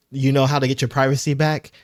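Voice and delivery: usual speaking voice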